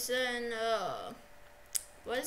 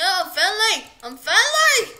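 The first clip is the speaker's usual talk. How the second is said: loud, higher desperate yell; shouting; 6 semitones higher, 14 dB louder than usual. Real, not acted. Player yelling at an in-game attacker, loud and pleading. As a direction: shout that you mean no harm.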